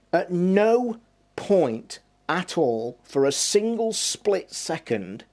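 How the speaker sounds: very slow, NO pulled high; slow, heavy stress on no in at no point at all. Real, not acted. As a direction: spell it out word by word. Emphatic point about never doing it.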